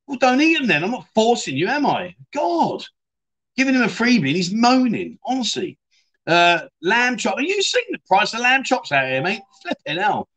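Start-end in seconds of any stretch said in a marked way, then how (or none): 0.1-5.8 mock indignation. Host scolding a viewer who moans about free food, mock outrage. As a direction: pitch jumps way up in exasperated disbelief.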